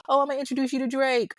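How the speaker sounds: high, fast imitation of a sleazy promise; mock smooth talker. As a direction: mimic his fake big-talk pitch. Mocking predator's usual pitch.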